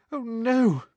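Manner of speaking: high-pitched dismay; quaking fear. A narrator's reading. Girl quaking as her knees give way, fearful. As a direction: let it out high and shaken. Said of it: "quaking so badly".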